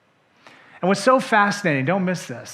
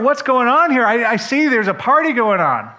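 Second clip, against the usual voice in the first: loud, high and eager; excited question; 6 semitones higher, 5 dB louder than usual. Acted. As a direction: ask the servant, bursting with curiosity. Older son asks servant excitedly.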